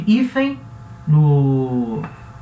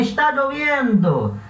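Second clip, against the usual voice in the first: joyful; 5 semitones higher than usual. Shown as a demonstration. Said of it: "Ou pode ser de alegria também".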